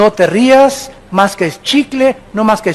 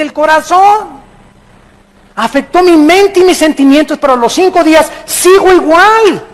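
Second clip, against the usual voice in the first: high, impassioned sermon delivery; forceful preaching; 5 semitones higher than usual. Real, not acted. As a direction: drive the point home to the congregation. Preacher builds to full force.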